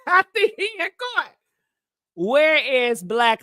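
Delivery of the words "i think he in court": high, fast, squealed through a laugh. Real, not acted. Cracking up over T D Jakes in court, laughing. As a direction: crack up while saying it. Repeats own joke while laughing.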